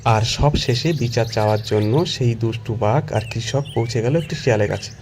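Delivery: plain reading voice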